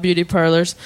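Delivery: normal talking voice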